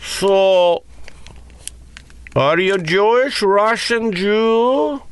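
strange Russian driver. Retold or imitated. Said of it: "convinced is owned by the Russian mob".

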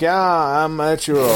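bad mock Japanese accent; Ichiro. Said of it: "I'm not gonna do a Japanese accent".

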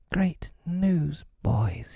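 high, excited storybook announcement; cried. A narrator's reading. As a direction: proclaim it high to the gang. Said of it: "he cried".

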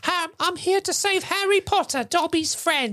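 high squeaky house-elf voice; Dobby voice. Retold or imitated. Host announcing Dobby's rescue in a high elf squeak. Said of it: "Fucking Do".

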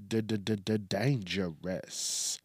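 slow stutter on D-d-d-d-dangerous, d sound repeated; stuttered echo. Shown as a demonstration.

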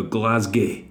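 "Glasgow" with a light Scottish vowel, GLAZ-geh; lightly Scottish "Glasgow", short a and clipped ending; "Glasgow" said a bit Scottish. Shown as a demonstration. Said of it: "if you're sort of, like, a bit Scottish, you go".

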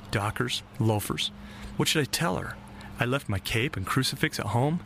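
plain reading voice

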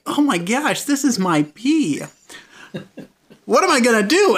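high-pitched dawning horror; mock panic. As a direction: play up the horrified realization. Retold horrified realization in the car.

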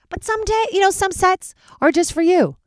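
lilting high sing-song; playful falsetto aside. Acted. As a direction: toss it off in a high teasing lilt. Singing-ish high playful aside.

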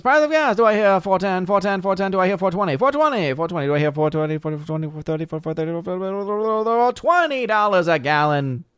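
high, rapid auctioneer patter. Pretend. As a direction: rattle off bids like an auctioneer. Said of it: "An auctioneer voice".

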